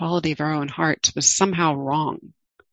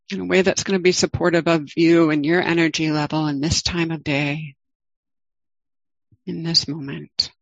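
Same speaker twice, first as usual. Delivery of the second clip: guided meditation. Acted. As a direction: ease listeners into the body, gently.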